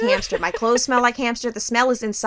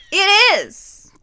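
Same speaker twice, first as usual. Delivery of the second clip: high, loud, fed-up insistence; exasperated high shout; 10 semitones higher, 9 dB louder than usual. Real, not acted. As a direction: wail it out in exasperation. Ranting, exasperated.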